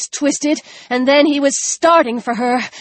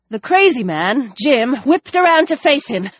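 ordinary delivery, nothing marked

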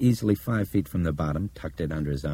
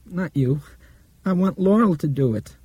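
put-on insistent child; 13 semitones higher, 4 dB louder than usual. Blind girl refuses, insistent.

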